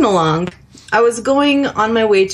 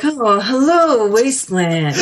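smooth DJ. Retold or imitated. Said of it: "You know, he's just smooth".

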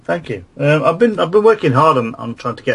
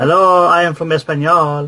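higher-pitched mock Spanish accent; hammed-up Spanish greeting, pitched up; 6 semitones higher than usual. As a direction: put on a cartoon Spanish accent. Puts on a Spanish persona.

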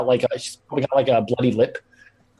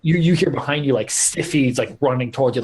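normal talking voice

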